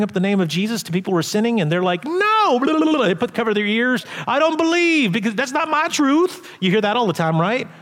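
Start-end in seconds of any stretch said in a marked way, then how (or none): 2.0-3.1 high, loud mock protest; mock a stubborn person shutting out; mocking refusal. Mocking sinners refusing Jesus. 4.3-6.5 high mocking voice; mock unbeliever.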